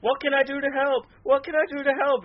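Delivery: imitating a manager desperate to help; mock eager helper. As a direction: play an overkeen sidekick. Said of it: "he was like".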